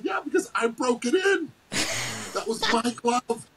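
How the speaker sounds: high, proud retold shout that slows at the end; cocky retort. Answering the kid who praises the glove, a high proud retort. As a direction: throw it back with pride.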